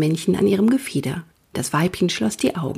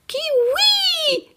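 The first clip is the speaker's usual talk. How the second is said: very high, drawn-out pained owl call; owl cry of pain. A narrator's reading. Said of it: "entfuhr dem Steinkauz ein Schmerzensruf".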